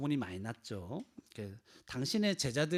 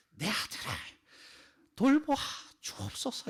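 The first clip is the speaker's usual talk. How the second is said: shouted plea; 8 semitones higher than usual. Acted. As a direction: cry out to be heard over a crowd, then drop away. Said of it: "어떻게 소리 지르면서 이 말을 해야 될지".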